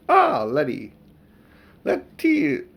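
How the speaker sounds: hammed-up fawning old brother; wheedling greeting. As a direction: coax her sweetly. Uncle Andrew greeting his sister.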